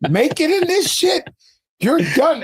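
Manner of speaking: high-pitched mock disbelief; incredulous father. Replayed imitation of doubting father.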